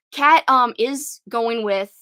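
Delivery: ordinary talk, nothing marked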